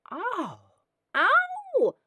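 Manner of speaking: high and loud, perking right up; loud eager woman. Acted. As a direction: squeal it, suddenly delighted and interested. Woman hears he killed wife.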